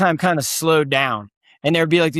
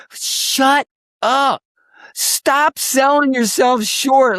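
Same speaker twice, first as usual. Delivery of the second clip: pitched-up rebuke, drawn out on selling; emphatic scolding; 8 semitones higher, much slower than usual. Real, not acted. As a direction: tell them off to lift them up. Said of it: "we're magnificent at selling ourselves short".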